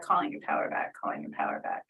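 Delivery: fading from soft to a whisper; whispered mantra. Shown as a demonstration. Healer repeating the mantra, fading into a whisper. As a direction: let the chant dissolve into breath. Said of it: "mantra fades to whisper".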